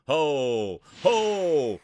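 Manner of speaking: thrilled caller's whoop; excited whoop. Real, not acted. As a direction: whoop at the big hit. Huge hit in the race.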